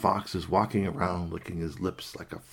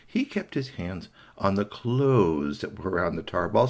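ordinary delivery

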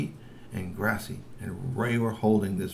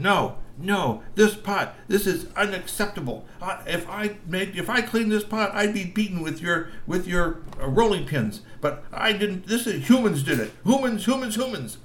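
high, insistent troll whine; protesting troll; 10 semitones higher than usual. Acted. Trolls protesting they won't be blamed for the pot, high and whiny. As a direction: insist it's the humans' fault.

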